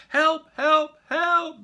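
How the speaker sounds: very loud, high panicked shouts; shouting for help. Acted. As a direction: yell for help from the cage. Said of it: "they all shouted".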